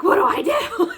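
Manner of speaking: panicked, half laughing; panicked laughing shout. Retold or imitated. Panicked question while gloved up.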